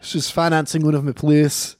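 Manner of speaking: put-on Irish playwright accent; hammed-up Irish. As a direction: attempt a broad Irish accent. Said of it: "I gotta work on that accent".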